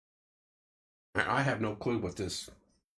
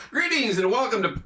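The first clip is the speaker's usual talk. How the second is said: big show-host welcome, pitched up and loud; announcer intro; 9 semitones higher, 7 dB louder than usual. Pretend. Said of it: "I gotta do my new intro".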